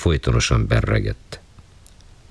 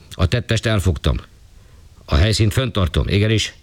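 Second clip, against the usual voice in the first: higher, formal, deferential report; dutiful policeman on phone; 6 semitones higher than usual. Acted. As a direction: report the murder crisply to a superior.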